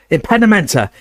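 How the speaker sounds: high yelled jinx, rolling over; mid-fight yell of a jinx; yelled spell. Acted. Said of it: "he yelled".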